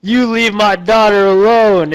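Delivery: mock heroic sheriff threat; stern sheriff. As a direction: lay down the law like a hammy movie lawman. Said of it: "and he's like".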